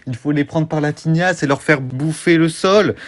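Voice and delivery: normal talking voice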